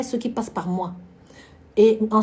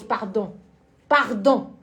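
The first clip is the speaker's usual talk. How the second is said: ordinary talk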